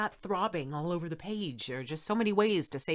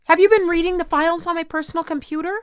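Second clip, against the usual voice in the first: loud, high, fast scolding of the son; 12 semitones higher, 13 dB louder than usual. Acted. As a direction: catch him red-handed and let him have it. Mother scolds son for snooping.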